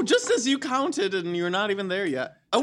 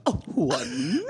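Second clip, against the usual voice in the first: vampire voice; 5 semitones lower than usual. Pretend. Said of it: "Do you have a vampire voice?".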